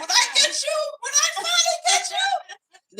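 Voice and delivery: very high squealed threat through laughter; hammed-up yell, cracking up; mock threatening mother. Mother threatening the runaway child, mimicked in a high laughing shout. Retelling mother chasing her, hilarious.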